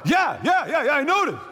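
of course I noticed, come on; exasperated yes. Retold or imitated. Pastor answering ushers who ask the obvious, exasperated. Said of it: "And I'm like".

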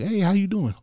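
high, breezy mock hello; imitating someone checking in lightly; casual greeting. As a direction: chirp it like a passing friend. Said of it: "people come up to you and be like".

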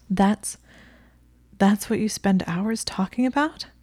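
a touch of breathless disbelief, rising at the end; half gasp it, stumbling over the start; mildly gasped stammering question. A narrator's reading. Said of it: "she gasped".

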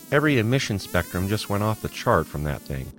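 eager report to the captain; a lightly voiced excited young officer. A narrator's reading. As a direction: blurt out the news, keyed up. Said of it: "Lance said excitedly".

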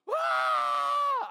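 a high, loud, startled scream; scared scream. Retold or imitated. Camp leader on a runaway horse, screaming in fright. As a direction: shriek as the horse bolts for the barn. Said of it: "And I'm like".

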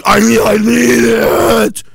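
slow, drawn-out plea in a put-on voice; stretched mock shout of need; mocking whiny voice. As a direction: play the pitiful glutton begging. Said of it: "You just".

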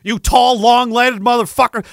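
pumped-up playful cursing at teammates; mock insults. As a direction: rib them like an exhausted buddy. Cursing his taller teammates.

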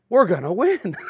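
giddy Irish-free fan voice, laughing into it. Retold or imitated. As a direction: sound like a kid who just got great news. Fans thrilled Gretzky joined the Kings.

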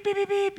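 high bleeping sounds covering curses; mock censor bleeps. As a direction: bleep out the coach's swearing, pitched high. Censoring the coach's curse words.

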